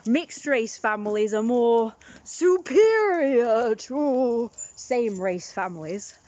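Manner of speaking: slow, loud, pompous mock voice; drawn-out pompous mimicry. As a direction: parody a smug announcement. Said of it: "It's like saying".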